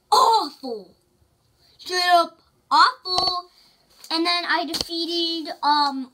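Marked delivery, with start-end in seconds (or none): 0.1-3.5 exaggerated disgust. Real, not acted. Complaining about bad champion.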